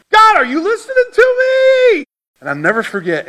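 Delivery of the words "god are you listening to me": much higher, louder, crying shout; angry cry to God. Retold or imitated. Preacher retells shouting at God in anger. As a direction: yell up at God, fed up. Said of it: "I started getting angry".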